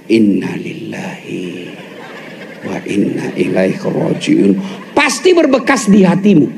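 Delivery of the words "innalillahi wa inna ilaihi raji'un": a whispered, drawn-out prayer of patience; slow low pious recitation. Shown as a demonstration. Husband murmuring to himself as wife nags, slow and hushed. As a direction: say it slowly, low and hushed, with feeling. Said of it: "perbaiki ucapannya".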